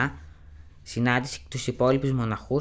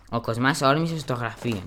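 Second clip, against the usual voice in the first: plain reading voice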